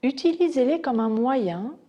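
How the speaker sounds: unhurried body-scan guidance with long pauses; guided meditation. Acted. Teacher guiding students' attention to floor and sounds, meditation voice. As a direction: guide their attention gently from point to point.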